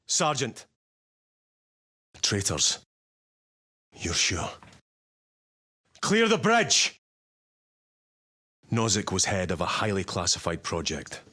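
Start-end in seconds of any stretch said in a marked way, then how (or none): 6.0-7.0 barked order. Acted. Officer ordering crew off the bridge, shouting. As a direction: shout it, much higher and louder.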